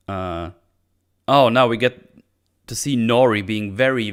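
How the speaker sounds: ordinary talk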